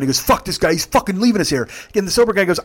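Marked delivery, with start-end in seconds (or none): 0.2-1.7 high, heated passenger grievance; retold angry complaint. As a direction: complain loudly as the abandoned passenger.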